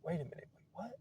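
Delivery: acted quiet double take; hushed disbelief. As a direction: murmur it like a baffled listener. Acts out listener's puzzled reaction.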